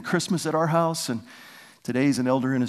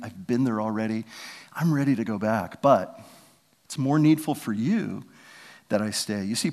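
ordinary talk, nothing marked

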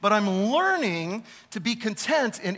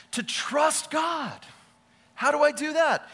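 stretched out, higher and ringing; preacher's cry; 7 semitones higher, slower than usual. Acted. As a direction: draw it out like a climax.

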